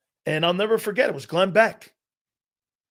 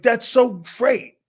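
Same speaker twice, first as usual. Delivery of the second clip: slow excited delight; 6 semitones higher, 5 dB louder, slower than usual. Retold or imitated. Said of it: "I was like".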